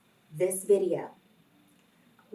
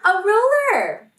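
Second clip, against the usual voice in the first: big reveal; 11 semitones higher, 11 dB louder than usual. Real, not acted. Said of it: "It is".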